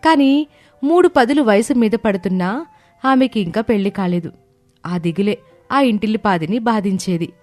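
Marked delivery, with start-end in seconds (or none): none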